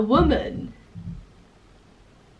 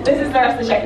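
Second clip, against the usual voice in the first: normal talking voice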